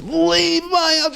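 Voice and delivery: husband intoning the divorce words to his wife; divorce formula. Retold or imitated. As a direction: pronounce "Leave my house" like a ritual verdict. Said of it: "He goes".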